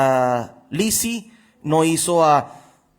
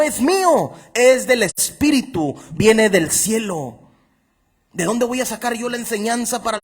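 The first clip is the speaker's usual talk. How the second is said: fervent preaching; 6 semitones higher than usual. Acted. Pastor preaching to his congregation, fervent and raised. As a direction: preach it out with fire. Preacher quoting Jesus forcefully.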